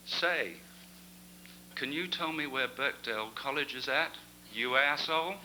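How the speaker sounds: American lady's cheeky punchline; a lightly voiced American accent. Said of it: "I can't do this accent either".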